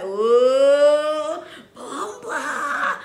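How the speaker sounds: loud startled whoa bumba; Jamaican shocked exclamation. Retold or imitated. Imitating how Jamaicans react to a surprise, loud startled exclaim. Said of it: "You know Jamaican people".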